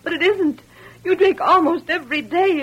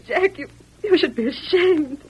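ordinary delivery

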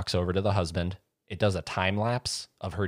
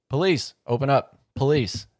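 louder, higher mock command; police calling out; 7 semitones higher, 6 dB louder than usual. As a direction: bark it like cops at the door. Police banging at the door.

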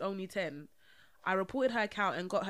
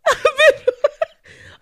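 loud laugh; 18 dB louder than usual. Real, not acted. Laughing at midwife joke.

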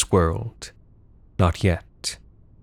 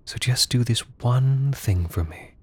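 menacing whisper; 2 semitones higher than usual. Acted. Whispered manipulation in embrace.

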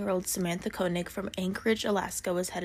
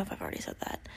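whispering; 9 dB quieter, faster than usual. Real, not acted. Aside while the dog comes down.

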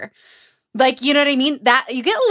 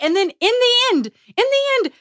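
dramatic build; 12 semitones higher than usual. Real, not acted. Dramatic retelling climax.

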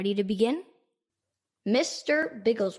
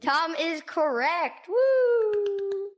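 excited cheer; 9 semitones higher, much slower than usual. Real, not acted. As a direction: cheer like they just won. Cheering a correct answer.

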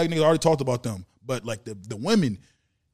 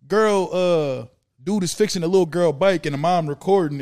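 usual speaking voice

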